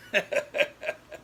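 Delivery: breathy chuckle at his own punchline; airy laugh at own joke. Real, not acted.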